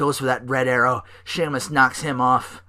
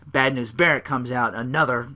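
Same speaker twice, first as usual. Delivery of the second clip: ordinary talk, nothing marked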